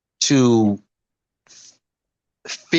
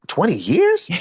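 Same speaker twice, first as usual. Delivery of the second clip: higher, rising incredulous question; shocked; 5 semitones higher, slower than usual. Retold or imitated. Singer retelling his reaction to people's praise, shocked. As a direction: echo it back in disbelief. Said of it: "I was shocked when people told me that".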